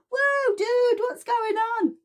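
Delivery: very high, bright mock-surprised call; cheery surprised tutor. Tutor reacting to Dexter's mistake, very high and cheery. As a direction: sound delighted even at a mistake. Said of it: "she's like".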